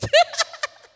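high, loud laughing burst; laughing at ending up in the men's room; burst of laughter. Real, not acted. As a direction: let a shriek of laughter out. Realizing men's bathroom.

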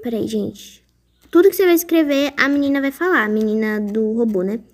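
ordinary delivery

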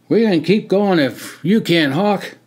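keen to back Hawk up; eager. Acted. Others eagerly agreeing.

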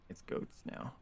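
whispered aside. Real, not acted. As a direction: whisper it to yourself. Repeats it under the breath.

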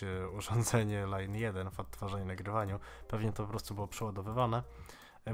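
ordinary talk

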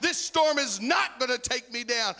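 high, loud, hammering the word not; loud preaching refrain. Acted. Preacher declaring victory over the storm to church, loud. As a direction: fire the line out, punching not. Refrain repeated, then slowed.

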